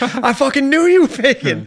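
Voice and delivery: high, loud, laughing mock yell; imitated outburst. Retelling Leslie Jones's sketch punchline, high mock shout.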